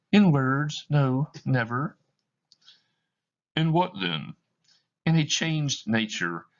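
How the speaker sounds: a broken heart parting; grieving woman. Acted. Woman breaks the engagement.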